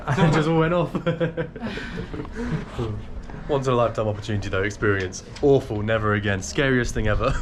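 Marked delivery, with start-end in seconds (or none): 1.0-3.4 high breathy giggle; high giggle of relief; relieved laugh. Real, not acted. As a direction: laugh off the scare. Laugh after fright.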